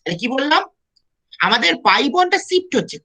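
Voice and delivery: ordinary talk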